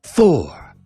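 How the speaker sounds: ordinary delivery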